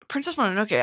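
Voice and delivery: ordinary talk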